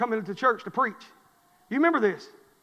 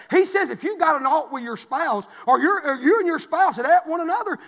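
rising, louder preacher's drive; building sermon; 6 semitones higher, 4 dB louder than usual. Acted. Preacher telling the church of his freedom, building. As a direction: build the fire back up.

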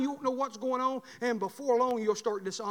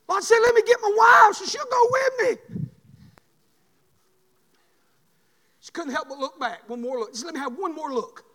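preaching; 8 semitones higher, 9 dB louder than usual. Acted. Preaching sermon.

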